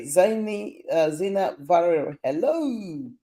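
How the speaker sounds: usual speaking voice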